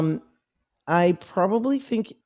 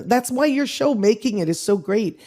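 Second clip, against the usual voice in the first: usual speaking voice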